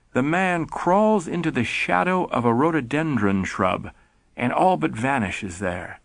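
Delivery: ordinary delivery, nothing marked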